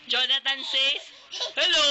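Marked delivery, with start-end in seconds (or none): 1.6-1.9 louder, higher bright hello; mock cheerful hello. Mother repeating the uncle's hello to make the baby laugh, loud. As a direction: pop out a jolly greeting.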